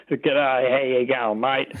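thick Aussie ocker twang, playful; broad Australian accent. Retold or imitated. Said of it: "my Australian accent, uh, doesn't sound, as strong as a lot of Aussies".

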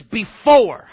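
"before" punched loud, second syllable stretched; stress on before. Shown as a demonstration. Preacher showing a reader the key word, heavy stress on "before". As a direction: hit "before" hard and draw it out. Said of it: "put some emphasis on that word before".

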